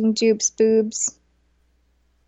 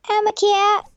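cat voice; 10 semitones higher, 3 dB louder than usual. Pretend. Voicing the cat.

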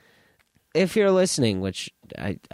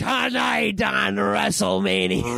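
slow hyped announcer voice; slow, big ring announcer; wrestling promo; 6 semitones higher, slower than usual. Acted. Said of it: "cutting a wrestling promo".

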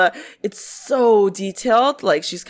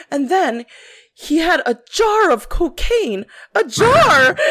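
building mock outrage; 6 semitones higher than usual. Retelling the witness's jar of cocaine, building up. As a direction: climb up to the punchline. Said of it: "and then she goes".

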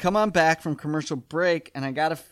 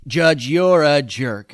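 loud, slow, drawn-out mock outburst; mock angry insult; 7 dB louder, slower than usual. Dad insults the judge in court, mock angry. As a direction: imitate an irate dad, hammering each word.

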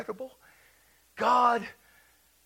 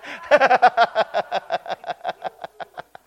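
long belly laugh; 3 semitones higher, 9 dB louder than usual. Real, not acted. Preacher yielding to joy, long trailing laughter. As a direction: burst into a long, stuttering laugh.

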